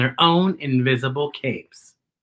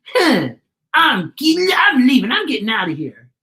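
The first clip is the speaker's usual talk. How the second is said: much higher, louder, whiny huff of a retreating bully; bully retreating with an indignant high whine; scoffing; 9 semitones higher, 6 dB louder than usual. Acted. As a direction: scoff and storm off. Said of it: "he's scoffing".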